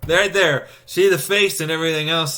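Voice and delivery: loud, high and eager to point it out; excited pointing. Real, not acted. To the viewer, pointing at a face, excited. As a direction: shout it out like you just spotted it.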